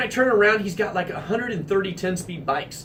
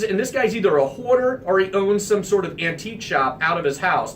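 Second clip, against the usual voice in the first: ordinary delivery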